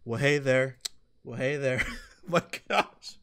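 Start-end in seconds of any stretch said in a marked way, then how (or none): 0.0-1.8 low, repeated robotic greeting; looping voice line. Retold or imitated. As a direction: mimic a stuck game character. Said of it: "He was like".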